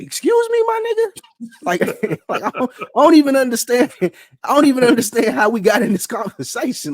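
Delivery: high-pitched, disbelieving retort with laughter breaking in; incredulous outburst. Retold or imitated. Comedian retelling his reply to a mall vendor, incredulous.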